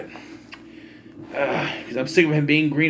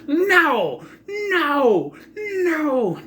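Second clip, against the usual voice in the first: imitated scream, very high and drawn out; Paul Heyman; 12 semitones higher, much slower than usual. Manager screaming as his wrestler flees, mock high wail. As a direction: wail it in mock horror, each one falling away. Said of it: "Paul Heyman screaming".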